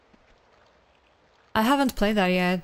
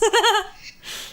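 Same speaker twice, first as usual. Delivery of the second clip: high laugh; 11 semitones higher, 3 dB louder than usual. Real, not acted. Joke about having no fans.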